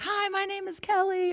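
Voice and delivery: high, mock yell over a band; high-pitched mock shout; retold yell. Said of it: "she yells".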